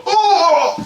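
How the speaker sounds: high, drawn-out "oh"; exaggerated high moan; dramatic mock wail. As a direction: imitate an over-dramatic mom's cry. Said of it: "my mom just going".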